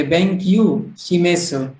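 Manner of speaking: बेंक्यू शिमेसु said as an English speaker would, kyu and me-su; mock a beginner's anglicized बेंक्यू शिमेसु; English-style mispronunciation. Shown as a demonstration. Teacher showing how non-Japanese family would misread it. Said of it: "English pronunciation ना".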